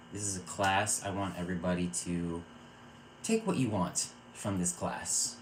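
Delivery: ordinary talk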